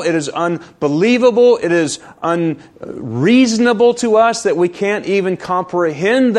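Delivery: high, emphatic pulpit delivery; forceful preaching. Real, not acted.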